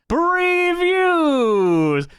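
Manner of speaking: high, drawn-out announcer's cry; big showy game-show holler; game-show segment announcement. Acted. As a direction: belt out the segment title, stretching it. Host announces the favorite segment.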